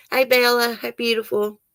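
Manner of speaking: normal talking voice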